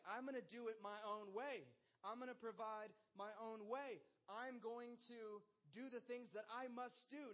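a hint of a stubborn rebel; mock self-will. Voicing a self-willed rebel.

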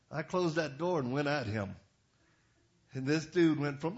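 usual speaking voice

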